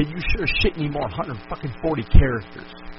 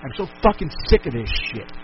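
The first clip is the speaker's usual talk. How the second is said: ordinary talk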